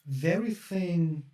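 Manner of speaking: ordinary talk, nothing marked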